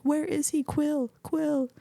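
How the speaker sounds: high, hushed mock shout; Drax calling out. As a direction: act out calling for a lost friend without raising volume. Retells Drax calling out for Quill.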